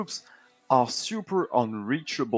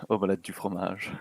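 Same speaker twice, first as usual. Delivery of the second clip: put-on quick, lower, softer French-accented phrase; mock French accent; 5 semitones lower, 8 dB quieter, faster than usual. Joking back at the caster in quick mock French accent. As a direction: toss off a cliché French line. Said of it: "Ah oui, baguette".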